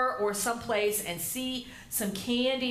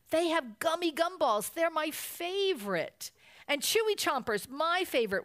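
whining; 8 semitones higher than usual. A narrator's reading. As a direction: plead in a higher cub's whine. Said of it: "whined brother".

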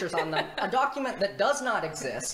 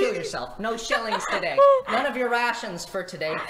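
laughing mock clerk; 3 semitones higher, 7 dB louder than usual.

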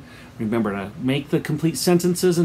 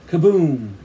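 loud imitated blast; booming falling kaboom; storyteller's sudden loud boom; bomb explosion; 3 semitones higher, 7 dB louder than usual. A narrator's reading. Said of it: "And another".

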